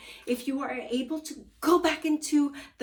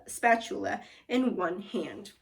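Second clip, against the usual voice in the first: ordinary delivery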